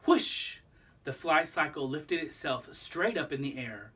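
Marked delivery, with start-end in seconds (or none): 0.1-0.6 a high breathy rush of air; a quick airy whoosh; unvoiced whoosh. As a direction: blow it out like a rocket lift-off. Fly cycle lifting off.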